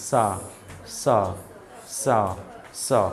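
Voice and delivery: সা with air hissed softly past the teeth roots. Shown as a demonstration. Teacher drills pupils on the sound, airy dental সা. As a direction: curl the tongue up for সা. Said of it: "দাঁতের মূল দিয়ে আসতে বাতাসটা".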